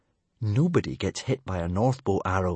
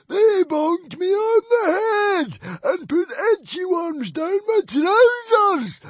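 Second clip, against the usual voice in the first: very high, loud horrified cry; the librarian wailing about the children; 7 dB louder, slower than usual. A narrator's reading. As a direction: wail in horror at the memory.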